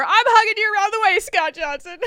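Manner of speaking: high, loud teasing call; gleeful taunting holler; playful shout. Real, not acted. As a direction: shout it across the room at him. Teasing shout at tall friend.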